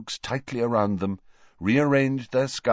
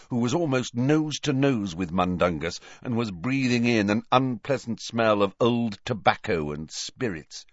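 plain reading voice

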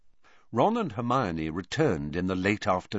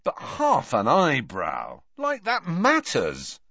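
grumpy; 6 semitones higher, slower than usual. Acted. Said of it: "said Ron grumpily".

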